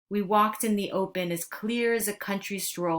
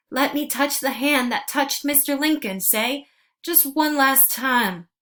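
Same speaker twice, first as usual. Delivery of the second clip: pleading farewell; 6 semitones higher, 5 dB louder than usual. Acted. Dying friend's last plea.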